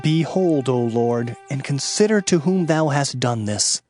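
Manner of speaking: usual speaking voice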